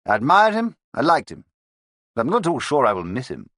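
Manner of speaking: a twittery gentleman reminiscing; fussy old inspector. A narrator's reading. Fifth man reminisces to fellow detectives, high and fussy.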